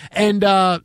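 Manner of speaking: ordinary talk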